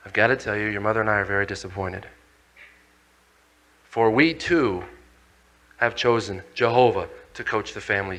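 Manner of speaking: low, soft, grave disappointment; disappointed father. Retold or imitated. Father telling Lucifer to leave, low and disappointed. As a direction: quietly send the rebel away. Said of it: "And he was mad".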